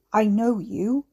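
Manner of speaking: lightly croaked, sudden recognition. Acted. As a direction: croak it out abruptly. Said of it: "Harry croaked suddenly".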